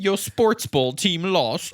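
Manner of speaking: slow, high, loud mock pity; mock sympathy. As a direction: tease him like a sad child. Mocking the kid.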